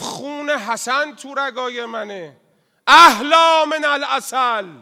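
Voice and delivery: high, loud and slow, rising to a cry; fervent declaration. Acted. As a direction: proclaim it with burning zeal. (اعلام پرشور و بلند)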